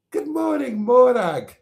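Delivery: Highland Scottish soap voice. Retold or imitated. Host mimicking an old Scottish soap opera, lilting Highland accent. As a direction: sing out a hammed-up Highland Scottish greeting. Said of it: "Take the High Road".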